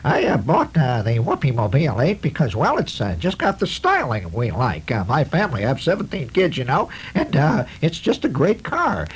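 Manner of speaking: high, nasal housewife voice; pinched nasal ad testimonial. Retold or imitated. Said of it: "kind of a nasal voice".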